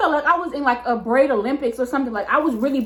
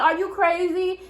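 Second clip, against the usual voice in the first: what is wrong with you; pointed mock disbelief; incredulous; 7 semitones higher than usual. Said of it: "I'm like".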